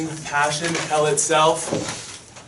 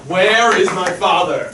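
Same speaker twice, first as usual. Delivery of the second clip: furious demand; 4 semitones higher, 6 dB louder than usual. Acted. Laertes storms in.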